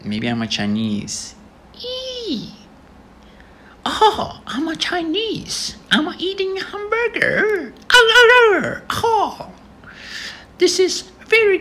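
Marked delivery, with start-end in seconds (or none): 1.8-11.6 very high, squeaky cartoon voice. Vlogger plays with a face filter, squeaky high voice.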